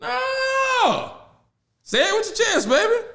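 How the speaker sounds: high-pitched mock refusal, drawn-out no; mocking taunt. Friend taunts barber who now begs, mocking high voice. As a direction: taunt him in a high, goading voice.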